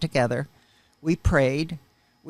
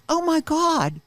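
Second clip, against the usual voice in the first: shocked gasp; 12 semitones higher, slower than usual. Retold or imitated. Sister sees welts in mirror.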